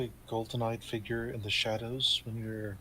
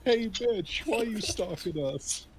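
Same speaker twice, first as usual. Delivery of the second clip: mock yell; 9 semitones higher than usual. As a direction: pretend to yell out a window, without the volume. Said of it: "open the window and yell".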